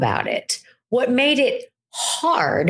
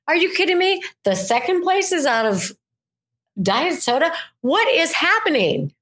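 higher, hammed-up disbelief; reenacting errands gone wrong, higher mock disbelief; mock exasperation; 7 semitones higher than usual. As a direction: play the fed-up customer, a touch over the top. Acting out mounting exasperation.

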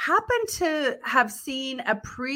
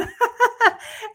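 sound; 13 semitones higher, 6 dB louder than usual. Real, not acted. Laughs mid-question.

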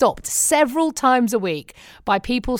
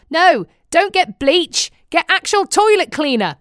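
retold shout; 7 semitones higher, 4 dB louder than usual. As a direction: call out to the girls over the shelves. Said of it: "I was shouting at the girls".

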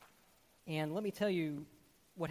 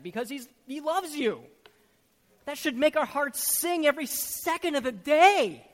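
loud, higher, urgent preaching; full-force preaching; 7 semitones higher, 12 dB louder than usual. Acted. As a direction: drive it home to the congregation.

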